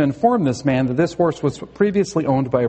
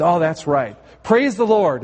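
rider's command. Acted. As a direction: call out the go signal, mildly hammed up.

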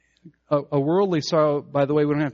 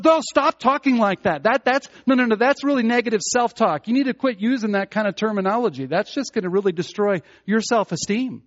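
higher and louder, hammed-up insistent therapist; mock scolding world; 7 semitones higher, 4 dB louder than usual. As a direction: shush them with pushy concern.

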